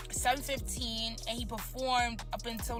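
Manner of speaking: normal talking voice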